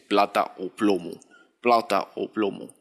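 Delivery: a light imitation of the actor's odd Paisa-Spanish accent on the famous line; Brazilian-tinged Escobar accent. Vlogger quoting Narcos' Escobar line, lightly imitated accent. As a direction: lightly mimic Wagner Moura's off Medellín accent as Escobar. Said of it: "se nota mucho el acento que dice".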